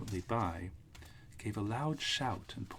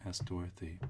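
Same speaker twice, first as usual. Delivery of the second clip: ordinary delivery, nothing marked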